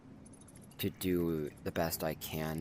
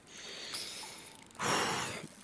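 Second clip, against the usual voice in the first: long sigh. Real, not acted. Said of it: "it's been emotional for me".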